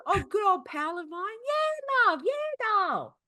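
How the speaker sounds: cheery London slang. Pretend. Playing a cheery London character.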